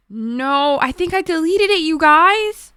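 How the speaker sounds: high, loud wail of dismay; theatrical high-pitched lament; dramatic dismay. Real, not acted. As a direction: wail it out, drawing out the last no. Dismay at deleted playlist.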